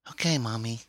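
put-on high-pitched child's reply; little girl's voice. A narrator's reading. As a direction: answer mother as an obedient child. Daughter answers her mother.

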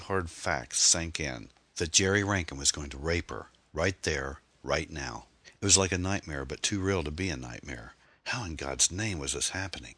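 ordinary delivery

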